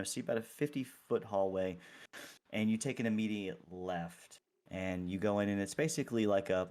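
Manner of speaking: usual speaking voice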